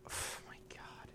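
horrified whisper. Real, not acted. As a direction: whisper it in disbelief. Co-host reacts in horror.

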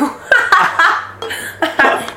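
high, breathy burst of laughter. Real, not acted. Storyteller after the legal-issue punchline, laughing. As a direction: crack up at your own story.

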